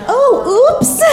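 high light little oops; high mock oops. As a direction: squeak it out lightly. Mock minor mishap.

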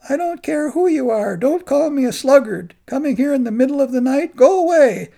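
high and loud, furious; angry refusal. Acted. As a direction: drive the visitor away. Angry monk refusing at door.

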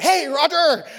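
high, loud cheerful hello, imitating an eager friend; bursting with morning energy, retold; excited greeting. Retelling a friend's eager early-morning greeting, high and loud. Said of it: "why is he so excited".

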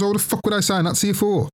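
high, fast mock irritated mother; annoyed mum. Mum pissed off at her son, high and fast. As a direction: rattle it off like a fed-up mum.

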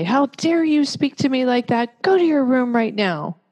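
triggered scolding. Shown as a demonstration. Parent snapping at a rude child, triggered and angry. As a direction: scold them for talking back. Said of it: "you can be triggered and s-, stay on the surface and say".

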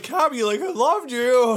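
high, loud adoring outburst; retold starstruck excitement, laughing edge; gushing fan. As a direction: gush at your childhood idol. Retold gushing fan meeting idol.

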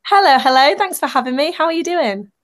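ordinary talk, nothing marked

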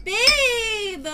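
high, loud protesting cry; exasperated call. Real, not acted. Woman scolding her partner over mic noise, exasperated high shout.

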